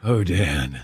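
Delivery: mock a breathy come-on; breathy singer. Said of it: "doing this in that breathy voice".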